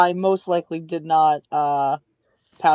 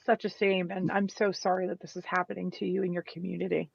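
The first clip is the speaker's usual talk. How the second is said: gentle, hushed condolence; quiet, tender in-character sympathy; soft sympathy; 10 dB quieter than usual. Acted. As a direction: offer sorrow softly, well under normal volume.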